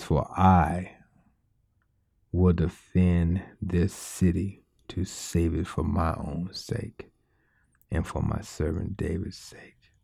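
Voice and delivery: low, slow, fading to whisper; whispered God voice. Acted. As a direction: let God's words sink to a whisper. God's words quoted.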